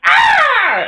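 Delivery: short, very high, loud screech; imitated bird scream. Co-host mimicking Ryu pelted with waves, mock eagle screech.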